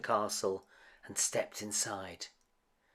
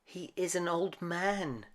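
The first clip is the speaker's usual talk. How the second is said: higher pleading voice; begging; 6 semitones higher than usual. A narrator's reading. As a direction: beg for her father. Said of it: "she begged".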